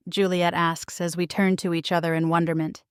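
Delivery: ordinary delivery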